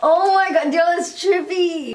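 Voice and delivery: high, loud excited outburst; amazed realization. Real, not acted. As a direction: blurt it out, freaked out and thrilled. Excited realization about the theory.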